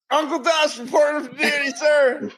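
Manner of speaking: high shouted cartoon soldier salute; 1930s cartoon voice. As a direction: bark it up high like an old cartoon private. Mock soldier reporting to host.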